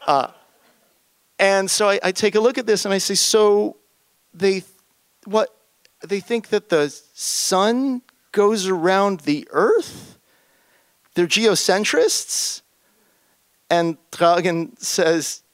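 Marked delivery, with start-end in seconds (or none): 3.1-12.6 high, disbelieving slow question; incredulous. Retold or imitated. Narrator to colleague, mock incredulous. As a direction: ask it as if it can't be true. Incredulous retold reaction to geocentrists.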